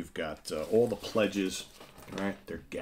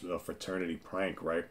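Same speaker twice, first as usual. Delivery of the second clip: usual speaking voice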